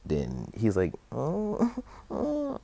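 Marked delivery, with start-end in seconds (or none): 1.1-2.6 very high little whimpers; mock crying. Izuku after burning his mouth, mock whimpering. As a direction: fake a child starting to cry, squeaky high. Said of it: "as he starts crying".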